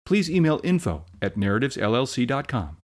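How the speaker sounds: soft, fast fine-print read; quick legal disclaimer. Acted. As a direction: rattle off the disclaimer quietly.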